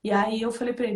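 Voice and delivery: ordinary delivery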